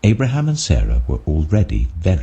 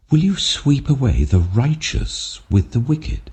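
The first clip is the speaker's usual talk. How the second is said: higher, earnest plea for mercy; pleading Abraham; 7 semitones higher than usual. A narrator's reading. Abraham bargains with the Lord, pleading in a raised voice. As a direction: beg the Lord, voice lifted high.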